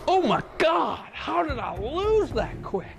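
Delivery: high, incredulous outburst; shocked disbelief. Real, not acted. Gamer reacting to a sudden loss, shocked disbelief. Shocked at losing the game quickly.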